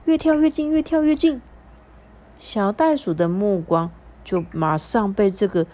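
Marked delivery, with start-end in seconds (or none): 0.1-1.4 high, building storyteller excitement; excited narration. A narrator's reading. Narrator telling children a hopper is coming closer, excited. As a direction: raise the pitch as the hopper approaches. Narrating someone hopping nearer.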